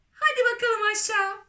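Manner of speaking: high, quick exclamation; excited send-off. Acted. Ladybird picturing the peach rolling down, excited send-off. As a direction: toss it out fast and high.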